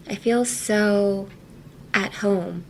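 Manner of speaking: "I feel so" slowly, pause, then "at home" softly; thoughtful pause. Shown as a demonstration. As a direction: pause after "so" as if searching for words. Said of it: "say it like Sophie".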